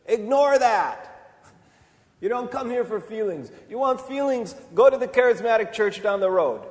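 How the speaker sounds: loud, high exhortation; fiery preaching. Acted. Preacher urging listeners to press on, loud and high. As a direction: drive them on like a revival preacher.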